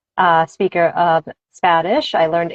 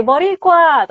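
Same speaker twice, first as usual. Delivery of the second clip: high, loud, proud-sounding call; exclaimed, pitched way up; calling out Boricua; 10 semitones higher, 4 dB louder than usual. Retold or imitated. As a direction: call it out like greeting a fellow islander. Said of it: "when you hear someone say".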